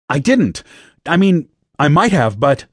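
protest, then backtrack; flustered defence. A narrator's reading. Recruit defends himself, cut off.

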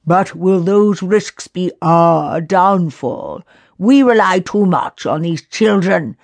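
stern council member questioning reliance; grave doubting elder. A narrator's reading. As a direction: weigh each word with doubt. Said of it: "Lockian stated".

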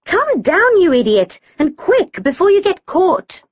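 high, urgent order called upward; urgent calling. A narrator's reading. Amber orders sister off the ladder, urgent calling. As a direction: call up an exasperated command.

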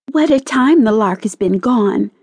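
high, lightly exclaimed impatience; fretful cry. A narrator's reading. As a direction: cry out mildly at the long wait. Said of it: "she cried at last".

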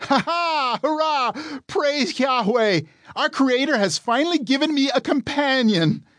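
giddy, high celebration; overjoyed cheer. A narrator's reading. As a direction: cheer like a child given a gift. Adam overjoyed to meet Eve.

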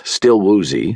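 ordinary delivery

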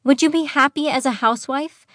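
high, impassioned questioning; worked-up, pleading demand; passionate outburst. A narrator's reading. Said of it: "Evelyn cried in a passionate outburst".

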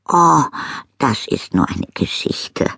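breezy and offhand, brushing it off; airily. A narrator's reading. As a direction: toss it away lightly. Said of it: "sagte Misses May leichthin".

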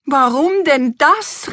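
high, drawn-out outraged question; indignant queen. A narrator's reading. Queen hears the plates are brass, indignant. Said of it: "rief die Königin aufgebracht".

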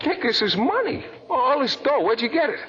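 stunned, giddy joy at the money; excited disbelief. Acted. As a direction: voice jumps high in amazed delight. Sees money in envelope.

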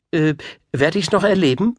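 high-voiced queen. A narrator's reading. Queen asking the wizard about her son, high voice. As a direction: lift the voice high for an eager question.

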